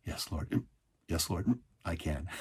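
low, soft retold answer to God; hushed, resigned assent; quiet surrender. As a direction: give in humbly, very softly. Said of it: "I took a deep breath, and I said".

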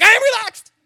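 squeaky high tense insistence, clearly not relaxed. Retold or imitated. Swimmer insists to Rambus he's relaxed, high and tense. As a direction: protest you're calm in a high strained yelp. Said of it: "That's my "I'm not relaxed" voice".

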